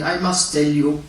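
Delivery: normal talking voice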